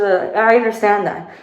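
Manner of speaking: ordinary talk, nothing marked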